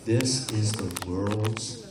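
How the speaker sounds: slow, low, soft eerie narration; spooky storytelling. Acted. Hypnotist builds dread for the scariest movie. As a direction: draw out each scarier.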